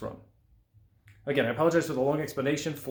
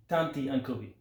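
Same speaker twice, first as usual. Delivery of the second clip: usual speaking voice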